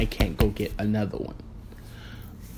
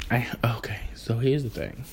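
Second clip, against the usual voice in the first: whispered setup before the story; hushed aside; 5 dB quieter than usual. Real, not acted. Whispering to viewers before telling the story.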